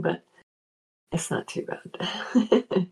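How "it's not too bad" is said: raspy, just-woken-up voice; gravelly morning voice. Real, not acted. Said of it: "it's a little gravelly in the morning".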